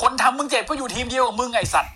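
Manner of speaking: high-pitched, exasperated jibe; mock scolding. Heckling the wrestler about his injury.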